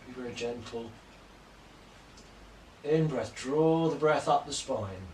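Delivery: calm, measured yoga-teacher cues timed to the breath; guided yoga instruction. Acted. Teacher guides home class through poses, calm paced cues. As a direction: talk the class through each pose breath by breath.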